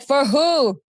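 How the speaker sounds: mock indignant pitch; annoyed retort. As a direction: snap back, fed up. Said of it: "I was like".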